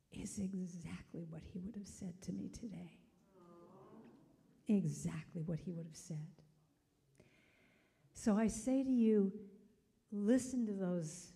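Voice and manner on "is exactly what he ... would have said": soft, near-whispered reply; moved hush. Retold or imitated. Widow moved by stranger's words, hushed. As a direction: let it drop to a tender hush.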